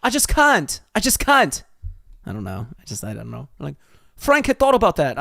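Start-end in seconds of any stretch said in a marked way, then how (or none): 0.0-1.6 loud, high protesting squeal; high-pitched protest. Real, not acted. Reader teased over a British accent, high-pitched protesting. 4.1-5.2 high, loud hammed-up British accent; bad British accent. Shown as a demonstration. Reader retrying a mocked line, in a high bad British accent. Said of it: "I just can't do a British accent".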